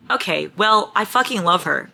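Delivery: usual speaking voice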